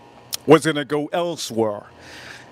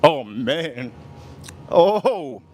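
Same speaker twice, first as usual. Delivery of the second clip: delighted laugh; much slower than usual. Real, not acted. Tasting the food, delighted.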